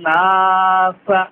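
নাসা drawn out long; long nasal stretch. Shown as a demonstration. Teacher modelling the stretch in নাসা. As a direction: hold নাসা. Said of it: "ও র আই তান".

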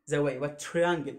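normal talking voice

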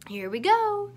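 usual speaking voice

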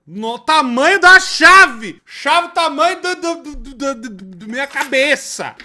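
loud, high, stunned yell; rising shocked outcry; shouting in disbelief. Real, not acted. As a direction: yell it, shocked by the giant key. Gamer shocked at huge key.

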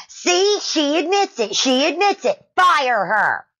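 very high-pitched mock excitement; triumphant outburst. Son telling the manager to fire her, high mock triumph. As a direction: jump in high, crowing like an accuser. Son jumps in, demanding a firing.

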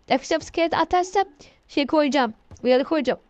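ordinary talk, nothing marked

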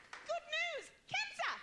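shrieking-high, upbeat Australian doctor; Australian uptalk. Acted. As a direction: announce it brightly with a hammed-up Australian lilt. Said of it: "so it sounds really, really good".

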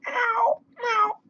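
very high kitty meows; cat meow. A narrator's reading. Cat calling to the busy spider, meowing. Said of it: "cried the cat".

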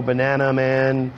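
ordinary talk

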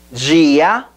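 DIA said "jee-a"; Portuguese pronunciation. Shown as a demonstration. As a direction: model DIA slowly for repetition as "JEE-ah".

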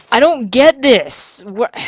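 loud, high, drawn-out complaint; real frustrated outburst; exasperated. Real, not acted. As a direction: wail it slowly in sheer confusion. Cannot solve the maze.

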